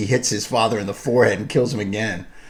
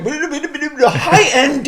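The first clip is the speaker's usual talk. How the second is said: mock high-pitched quote. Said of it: "and he's like".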